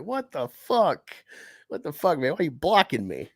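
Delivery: mock disbelief at being blocked; incredulous. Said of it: "I'm just like".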